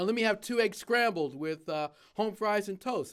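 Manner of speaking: mock shout of a diner order; calling out orders. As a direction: manager calling an order back to the cook, loudly. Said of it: "to shout out the orders".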